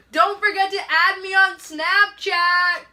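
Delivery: high, loud, drawn-out call; full-voiced imitation of a parting shout. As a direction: holler it across the beach as he leaves. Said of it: "he shouts at me".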